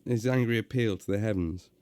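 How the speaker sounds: ordinary delivery, nothing marked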